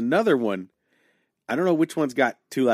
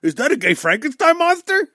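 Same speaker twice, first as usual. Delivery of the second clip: squeaky high mock gasp; shrill comic voice; high silly voice; 13 semitones higher, 6 dB louder than usual. Mocking bit about tall man.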